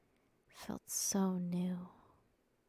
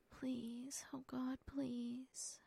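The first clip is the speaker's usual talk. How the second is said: higher, much softer pleading, slow; whispered begging; 4 semitones higher, 8 dB quieter, much slower than usual. A narrator's reading. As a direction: plead with him, drawing the words out. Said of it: "you begged".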